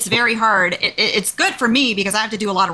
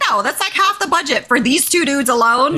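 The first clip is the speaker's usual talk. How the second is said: higher-pitched retold objection; exasperated refusal; 6 semitones higher, 4 dB louder than usual. Manager turning down developers' pricey monitor request, exasperated. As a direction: push back like a fed-up manager.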